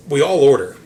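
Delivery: "all" punched hard and loud; stress on all. Real, not acted. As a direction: lean into "all" loudly. Building up to the waking moment.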